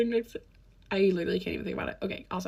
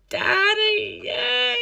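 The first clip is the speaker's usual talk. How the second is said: a sudden squeal of a hammed-up cheer, much higher; mock cheer; 14 semitones higher, 5 dB louder, much slower than usual.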